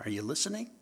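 ordinary talk

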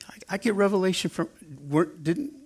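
high-pitched, stammering mock objection; lightly acted, wounded stammer; Peter's flustered protest. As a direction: sputter in protest and leave it unfinished.

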